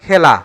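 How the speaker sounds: "খেলা" as khæla; open e pronunciation. Shown as a demonstration. Teacher names the open pronunciation, "খেলা" as khæla. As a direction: say "খেলা" with a wide æ. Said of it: "এর বিবৃত উচ্চারণ".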